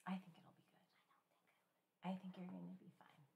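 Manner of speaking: slow, hushed comfort; very soft, private reassuring aside; whispered reassurance. Real, not acted. Quietly reassuring the worried child.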